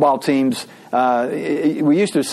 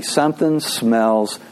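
announcing the sermon title; 3 semitones lower, slower than usual. Shown as a demonstration. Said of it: "that's my sermon title for Sunday".

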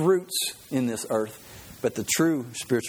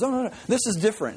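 salesman insisting; 8 semitones higher, faster than usual. Retold or imitated.

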